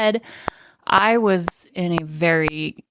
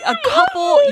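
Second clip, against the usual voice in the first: wheezing laugh; 5 dB louder than usual. Real, not acted. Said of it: "then just wheezing".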